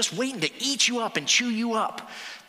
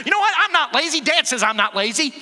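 proud comeback; 6 semitones higher, 6 dB louder than usual. Retold or imitated. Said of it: "she said".

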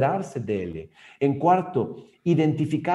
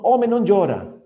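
louder, higher scolding father; a father snapping at his crying boy; stern father; 6 semitones higher, 7 dB louder than usual. Retold or imitated. Father scolding his crying son, loud and stern.

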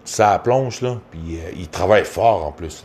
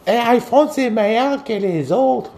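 mocking ditzy woman. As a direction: drag it out in a squeaky high voice. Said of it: "Les hosties de connes qui disent".

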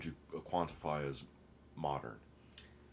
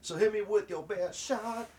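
singsong high; 7 dB louder, slower than usual. Acted. Playful high slow challenge.

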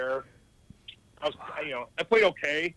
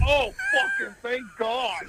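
higher and louder, slow burst of relief; mock-gush with relief; relieved; 10 semitones higher, 5 dB louder, slower than usual. Recalling hearing of the trade, mock relieved outburst. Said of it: "I'm like".

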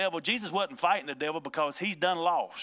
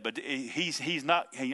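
ordinary talk, nothing marked

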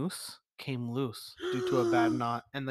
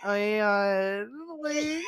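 higher, slow, hammed-up camp voice; campy judge; 5 semitones higher, slower than usual. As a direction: overdo the flamboyant judge.

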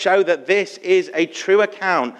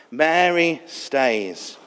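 Mary stays, very slow and drawn out; slow emphatic repeat; much slower than usual. Real, not acted. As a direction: let each word of "Mary stays" linger.

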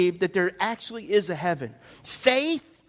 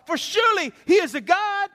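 high-pitched mock reverence; 10 semitones higher, 4 dB louder than usual. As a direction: jeer at their god, high and emphatic. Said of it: "Elijah began mocking them".

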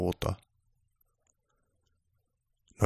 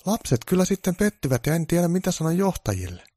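disappointed producer; 10 semitones higher than usual. Acted. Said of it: "tuottaja sanoi pettyneen".

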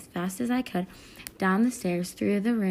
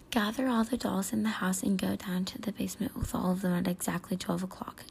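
hushed, breathy rasp of a stranger; raspy whisper; 4 dB quieter than usual. Acted. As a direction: whisper it like a creepy caller. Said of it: "There was a raspy but familiar voice".

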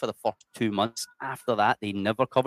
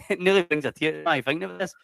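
emotional laugh; 7 semitones higher, 3 dB louder than usual. Real, not acted. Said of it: "it nearly brings a tears".

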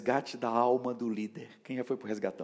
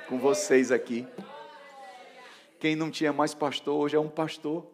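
raised, louder testimony; triumphant preaching; 5 semitones higher, 4 dB louder than usual. Real, not acted. Former orphaned disciple now pastor, triumphant proclamation. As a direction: ring it out to the congregation.

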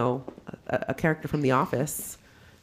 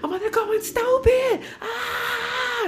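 much higher squealing cheer; hyped shout; much slower than usual. Retold or imitated. Retelling her own hype at the bar, high excited squeal. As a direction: screech it out at the dance floor. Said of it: "I was like".

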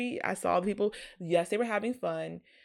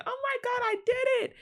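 much higher, thrilled shout; retold ecstatic scream; 4 dB louder, faster than usual. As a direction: relive the moment you won, squealing. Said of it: "I remember I screamed".

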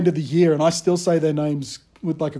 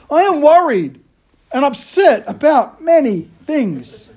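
much higher and louder, dragged slowly; mock exasperation; 8 semitones higher, 5 dB louder, slower than usual. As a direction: wail it like a harried dad. Self-mocking exasperated punchline.

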